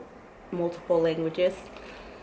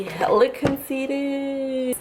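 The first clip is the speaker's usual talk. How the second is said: mock conceited; 7 semitones higher, much slower than usual. Mock vanity looking in mirror.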